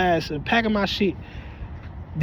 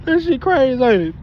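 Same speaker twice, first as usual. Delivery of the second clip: high, loud disbelief; louder, higher that shit crazy; excited exclaim; 5 semitones higher, 4 dB louder than usual. Real, not acted. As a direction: exclaim it with a laugh.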